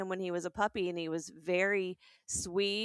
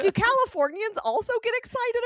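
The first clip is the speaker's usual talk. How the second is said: mock earnest question; 6 dB louder than usual. Mock-serious survey question.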